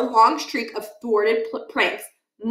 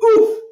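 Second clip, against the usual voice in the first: muffled oof; 12 semitones higher, 8 dB louder than usual. Acted. Pie hits face.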